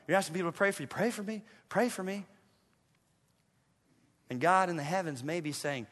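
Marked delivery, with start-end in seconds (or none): 0.9-2.3 soft, small put-on plea; mock pleading. Preacher imitating people asking for prayer, soft mock plea. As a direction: beg softly, imitating the needy.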